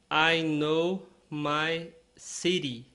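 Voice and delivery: slow, clear English line; slow model sentence. Shown as a demonstration. Teacher modelling slowly for learners. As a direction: spell it out slowly. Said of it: "model sentence".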